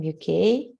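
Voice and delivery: usual speaking voice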